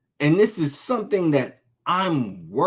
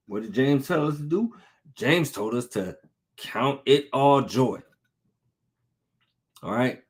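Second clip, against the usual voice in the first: ordinary delivery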